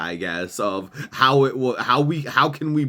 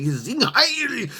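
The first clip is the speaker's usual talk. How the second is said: a much higher, hammed-up parody of a rally chant; lightly voiced mock Hitler cheer; mock Hitler rally cry; 11 semitones higher than usual. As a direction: bark it like a cartoon dictator. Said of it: "Hitler being like".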